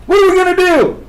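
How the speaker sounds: desperate high-pitched cry; imitating the panicked passenger in the riot; freaking out. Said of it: "Freaking out".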